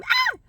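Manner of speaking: sudden high piercing scream; startled scream. Retold or imitated. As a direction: shriek as if someone banged on the glass. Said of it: "So I scream".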